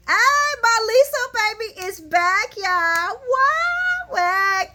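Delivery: high, loud, drawn-out hype shout; excited cheer. Real, not acted. Fan hails [name] baby being back, excited cheer. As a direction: whoop it up for a favourite's comeback. Hyping [name]'s return to the show.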